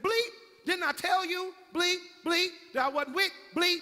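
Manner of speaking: loud, high furious ranting; cussing out. Acted. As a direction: cuss them out and threaten. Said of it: "Peter got to cussing".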